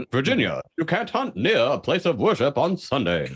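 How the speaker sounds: mid-Atlantic newsreel read; old radio announcer delivery; 1950s narrator. Shown as a demonstration. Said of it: "Like that kind of a".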